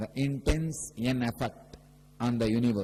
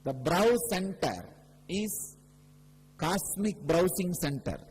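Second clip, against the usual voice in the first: higher, louder preaching voice, slowing on key phrases; fervent preaching; 6 semitones higher, 4 dB louder than usual. Acted. Guru preaching about the third eye to devotees, raised and fervent. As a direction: proclaim it to the whole hall.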